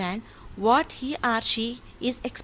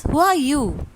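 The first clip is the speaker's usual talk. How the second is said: called out a bit louder and higher; light yell; 4 semitones higher, 5 dB louder than usual. A narrator's reading. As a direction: call out into the mountains. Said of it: "he yells".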